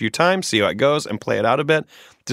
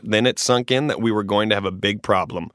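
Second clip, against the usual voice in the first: normal talking voice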